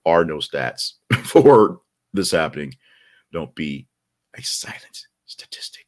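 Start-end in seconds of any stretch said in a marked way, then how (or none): 3.3-5.8 eerie hushed whisper; ominous whispered tagline; spooky whisper. Pretend. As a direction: breathe it like a ghost story line.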